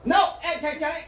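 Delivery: loud, high cutoff; loud interruption. Real, not acted. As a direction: cut him off sharply. Cutting off partner.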